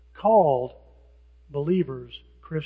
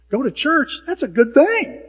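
higher, louder, bright approval; pleased, higher and louder; approving; 7 semitones higher, 8 dB louder than usual. Retold or imitated. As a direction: cheer them on for going to church. Imitating an approving person.

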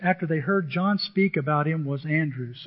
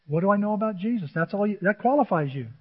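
ordinary delivery

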